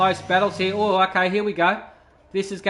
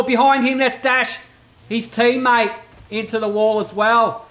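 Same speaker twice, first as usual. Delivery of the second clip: urgent, higher-pitched race commentary; excited crash call; 4 semitones higher, 3 dB louder than usual. Real, not acted. Commentator spots teammate Dash hitting the wall, excited.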